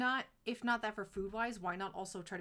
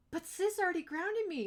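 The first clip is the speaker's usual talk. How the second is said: put-on whiny kid protest; 9 semitones higher, 5 dB louder than usual. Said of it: "Mega's like".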